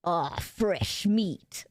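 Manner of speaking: slow low butcher growl; menacing butcher. Acted. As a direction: savor the words like a monster. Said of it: "Can you do the butcher voice next".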